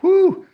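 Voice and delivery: loud, high celebratory whoop; real cheer after finally winning; whoop of joy. Real, not acted. Cheering the win.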